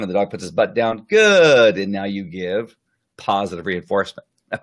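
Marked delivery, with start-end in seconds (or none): 1.1-1.8 praise. Shown as a demonstration. Praising the dog after sitting.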